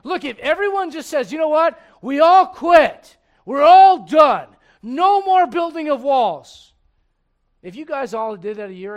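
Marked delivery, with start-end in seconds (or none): 1.3-6.7 loud, slow, high declaration of giving up; mock quitting shout. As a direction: shout it like a whole crowd quitting. Voicing quitters in a sermon.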